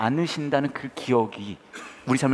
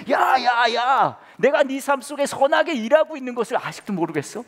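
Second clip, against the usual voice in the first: God scolding; 12 semitones higher than usual. Acted. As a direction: call them out sharply, raised voice. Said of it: "하나님은 뭐라고 하시냐 하면". (하나님이 답답해서 호통치듯)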